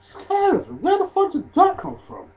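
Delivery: high-pitched retold bafflement; incredulous disbelief. Fan retelling his shock at a wrestler's fear, high incredulity. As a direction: pitch it way up, as if you can't believe it.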